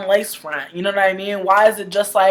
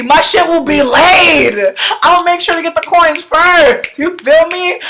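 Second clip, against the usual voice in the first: high, loud emphatic bragging; raised, hyped-up declaration; loud boast; 8 semitones higher, 5 dB louder than usual. Real, not acted. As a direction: proclaim it loudly to the whole audience. Boasting about her own look.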